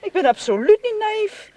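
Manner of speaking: high, indignant demand. Acted. Marion, stung by his insinuation, demands answers indignantly. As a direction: fire back, stung and offended.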